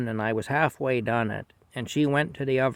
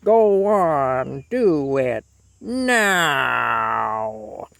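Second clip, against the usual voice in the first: supervisor's roar; 2 semitones higher, 8 dB louder, much slower than usual. Retold or imitated. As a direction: imitate a boss bellowing in someone's face. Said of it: "Just like that".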